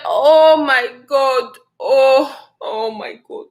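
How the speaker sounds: slow, drawn-out imitation of shock; stunned disbelief. Retold reaction to pregnancy news.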